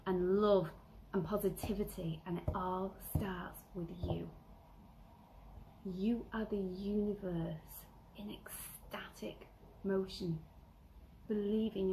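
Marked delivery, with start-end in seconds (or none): 2.3-10.4 slow whispered affirmation; soft, hushed uplifting close. Acted. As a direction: whisper it like a blessing.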